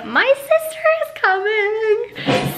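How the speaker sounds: high, slow, squealing with excitement; overjoyed, voice cracking with glee; excited squeal. Real, not acted. Telling viewers her sister visits, high excited squeal.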